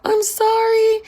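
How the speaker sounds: high, flustered apologetic clerk voice with a nervous laugh; flustered saleslady. Retold or imitated. Imitating the clerk caught out and apologizing, high and flustered. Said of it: "She's like".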